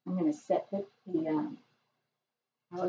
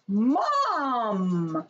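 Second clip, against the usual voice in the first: put-on higher, slightly louder child's cry; little girl voice; 4 semitones higher, 4 dB louder than usual. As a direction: call Mom like a surprised little girl. Nanette voiced in story.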